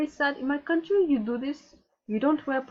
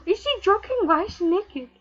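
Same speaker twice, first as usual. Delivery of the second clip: normal talking voice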